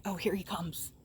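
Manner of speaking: ordinary talk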